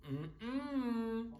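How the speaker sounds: high, drawn-out warning hum. Retold or imitated. Patrick stopping actor from breaking character, warning hum. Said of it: "Patrick would come in my line and say".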